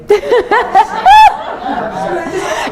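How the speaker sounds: high, loud laugh; burst of laughter. Real, not acted. Laughing at tea interruption.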